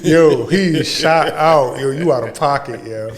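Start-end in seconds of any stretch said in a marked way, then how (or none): 0.1-1.8 slow, laughing disbelief. Real, not acted. Shocked cohost reacting to an out-of-pocket line, laughing. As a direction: drag it out through laughter. Reacting to partner's outrageous remark.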